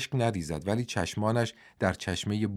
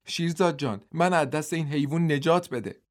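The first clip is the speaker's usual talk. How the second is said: screaming plea; 7 semitones higher than usual. Acted. Beaten woman screaming to her rescuer for help, high and desperate. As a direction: shriek it out as a beaten woman begging. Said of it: "ربابه جیغ کشید".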